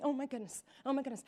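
a hint of a worried wife at bedtime; anxious fretting. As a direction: fret quietly in bed. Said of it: "suddenly start to be thinking".

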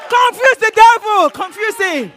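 loud, high battle cry; rousing shout. Real, not acted. Preacher urging congregation to confuse the devil, shouting. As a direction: urge them on at full voice.

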